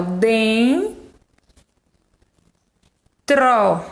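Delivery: "Den" nasal, then a pause, then an open "tro"; syllables split apart. Shown as a demonstration. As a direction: break the word into "Den" and "tro". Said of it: "Tro é a parte do som aberto, mas den". ("Den" nasal, pausa, "tro" aberto)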